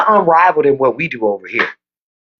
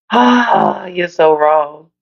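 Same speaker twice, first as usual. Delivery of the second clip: drawn-out amused scolding; laughing wail; 3 semitones lower, much slower than usual. Real, not acted. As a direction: wail it slowly through laughter. Cracks up at viewers' comments.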